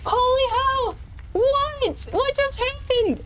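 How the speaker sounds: high, giddy astonishment; stunned disbelief. Real, not acted. Streamer reeling after beating the game, astonished. Stunned by winning the deathless run.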